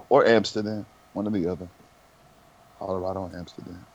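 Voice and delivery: usual speaking voice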